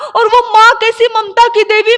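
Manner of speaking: usual speaking voice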